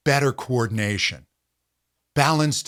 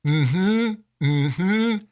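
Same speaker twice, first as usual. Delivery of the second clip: higher, buzzing mm-hmm felt at the lips; resonant agreeing hum; 5 semitones higher than usual. Shown as a demonstration. Teacher demonstrating a buzzing mm-hmm to students. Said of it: "it helps to go like this to isolate it".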